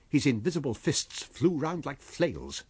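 plain reading voice

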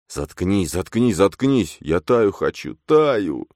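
a desperate broken cry; frantic repeating. A narrator's reading. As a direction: repeat it like a wound-up toy. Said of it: "твердил это как заведённый".

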